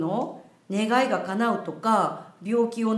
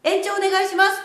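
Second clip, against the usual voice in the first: called out loudly, higher; loud request; 8 semitones higher, 4 dB louder than usual. Shown as a demonstration. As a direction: call it out for all to hear. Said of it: "って大きな声で言うと".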